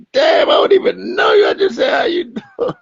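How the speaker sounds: high, wailing mock sob, hammed-up; mock crying shout. Comedian wailing over a rude rejection, hammed-up crying shout. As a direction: wail like a spurned guy, all for laughs.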